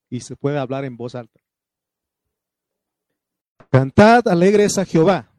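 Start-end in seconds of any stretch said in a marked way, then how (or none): 3.7-5.3 loud call. Acted. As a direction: proclaim loudly, leading the congregation. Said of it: "diga conmigo".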